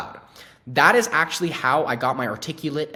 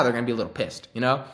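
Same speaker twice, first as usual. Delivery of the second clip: ordinary delivery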